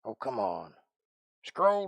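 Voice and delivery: normal talking voice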